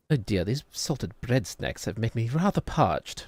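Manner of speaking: slow mock-British, drawn-out and swooping; posh British accent. Streamer parodying British talk, slow posh British accent. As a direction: play the fussy, posh Brit. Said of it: "That's how British people talk".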